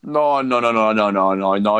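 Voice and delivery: rising volume, repeated no's pushed out hard; loud protest. Real, not acted. To his friend who prefers Cruella, a loud protest. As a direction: shut the idea down loudly.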